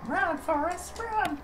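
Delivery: high-pitched quoting shout; movie quote shout. Pretend. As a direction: yell it like the famous movie line. Quoting Forrest Gump line.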